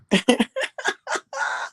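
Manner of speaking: high-pitched burst of giggles; helpless high giggle; laughing. Real, not acted. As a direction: crack up mid-story with a high laugh. Cracks up at her own story.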